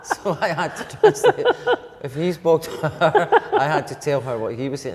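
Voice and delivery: telling it through real laughter; words bubbling up through laughter; laughing. Real, not acted. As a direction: laugh along while recounting the absurd mix-up. Laughing through the translating anecdote.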